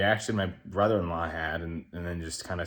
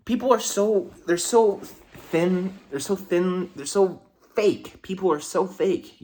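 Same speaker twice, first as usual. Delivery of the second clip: higher, louder, stretched-out exasperation; indignant rant; 11 semitones higher, 6 dB louder than usual. Real, not acted. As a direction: vent your disgust at fake people. Rant about fake people.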